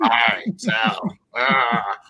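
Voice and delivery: hammed-up floor trader cry; mock trader shout.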